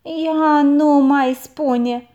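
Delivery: slow, loud, astonished; aunt can't believe the boy's cheek, slow and loud; amazed aunt. A narrator's reading. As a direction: drag it out in disbelief. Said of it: "se miră mătușa".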